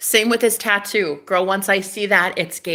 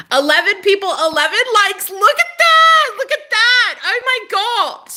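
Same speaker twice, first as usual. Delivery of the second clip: high excited shout; 14 semitones higher, 7 dB louder than usual. Real, not acted. Showing viewers her likes, shouting high with excitement. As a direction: squeal at the numbers.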